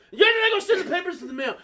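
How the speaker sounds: high, fast, loud yell; mom yelling. Retold or imitated. Mom turning from the phone to son, yelling. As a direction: snap it out loudly and quickly. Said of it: "like, yelling at me".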